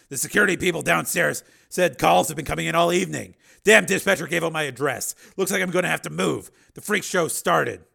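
loud, agitated grumbling; angry complaint. Acted. Man rants about being hounded.

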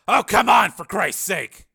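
loud, high outburst; fed-up cry of protest; exasperated protest. Acted. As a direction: object loudly in disbelief. Steele objects to body search.

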